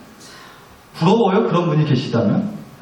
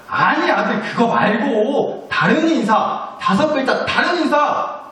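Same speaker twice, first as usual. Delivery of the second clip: higher-pitched, drawn-out correction; insistent mother; 7 semitones higher, slower than usual. Acted. Mother correcting her son's five-syllable greeting, insistent and high. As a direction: play an exasperated mom prompting her kid.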